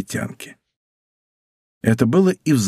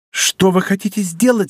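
higher, urgent exclamation; alarmed cry; 5 semitones higher than usual. A narrator's reading. Bonacieux stops d'Artagnan at the door, alarmed cry. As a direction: cry out to stop him. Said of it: "вскричала госпожа Бонасье".

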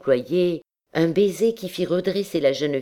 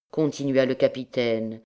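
plain reading voice

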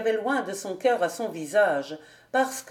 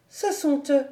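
a higher, startled exclamation; alarmed cry; 6 semitones higher than usual. Acted. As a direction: gasp it out in alarm. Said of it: "s'écria Alix".

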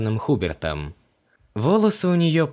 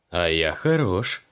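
smirking; 5 semitones lower, slower than usual. A narrator's reading. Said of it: "ухмыльнулся Мак".

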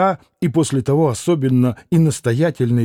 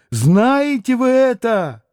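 much higher second asking; fervent ask, peak; 9 semitones higher, slower than usual. A narrator's reading. Катерина Ивановна повторяет вопрос Алёше, ещё выше и горячее. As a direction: stress вы, pitched way up. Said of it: "воскликнула вдруг с необыкновенным жаром".

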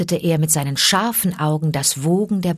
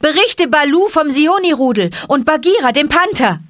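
calling out; 10 semitones higher, 7 dB louder than usual. A narrator's reading. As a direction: call it up into the sky. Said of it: "rief Mowgli ihm zu".

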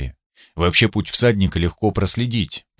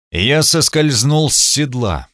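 usual speaking voice

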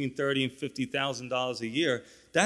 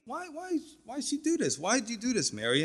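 much higher, squeaky scolding voice; mocking disciples; 10 semitones higher, 3 dB quieter than usual. Disciples ganging up to scold Mary, high mock whine. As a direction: ham up the whiny, nagging crowd. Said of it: "they all started to see it and say".

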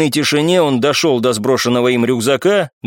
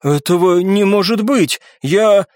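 higher, stunned denial; shocked disbelief; 6 semitones higher, slower than usual. A narrator's reading. Young man denying his mistake, shocked. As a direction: falter in dismay. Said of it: "побледнел парень".

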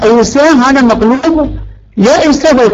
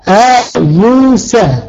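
slow demo word; much slower than usual. Shown as a demonstration. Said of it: "يحذف إلا أم لا؟".

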